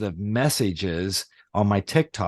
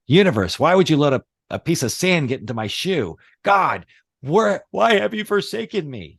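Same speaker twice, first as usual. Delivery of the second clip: mock despair; 6 semitones higher, 3 dB louder than usual. Mock complaint to the universe.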